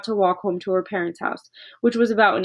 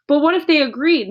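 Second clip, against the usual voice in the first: crying eagerly; 6 semitones higher, 5 dB louder than usual. Acted. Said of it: "Matilda cried eagerly".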